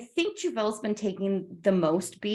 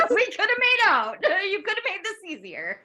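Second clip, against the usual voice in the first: much higher, laughing through a teasing sorry; laughing mock apology; 10 semitones higher than usual. Player replaying her character's goodbye, laughing. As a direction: giggle it out, teasing. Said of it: "I'm just like".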